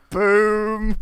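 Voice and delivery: high, loud, drawn-out boom; triumphant exclamation. Retold or imitated. Host celebrating a pun in the show, triumphant. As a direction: land it like a punchline hitting.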